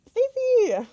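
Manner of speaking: loud high call across the room. Real, not acted. Calling to someone in another room, loud and high. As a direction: shout the name to get her attention.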